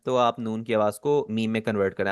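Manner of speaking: usual speaking voice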